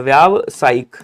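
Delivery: व्यावसायिक slowly split, long "vyaav", then "saayik"; correct form, spelled out. Shown as a demonstration. Teacher dictating how to write it, long-vowel syllables. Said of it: "ऐसे लिखेंगे".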